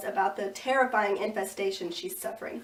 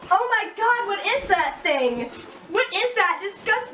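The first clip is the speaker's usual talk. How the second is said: shocked scream; 8 semitones higher, 4 dB louder than usual. Acted. Reporter sees monster.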